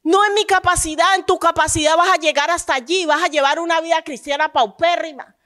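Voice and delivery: loud, high, driving sermon climax; preaching at full force. Real, not acted. Pastor rising to full force before the congregation, preaching. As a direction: preach it out with fire to the church.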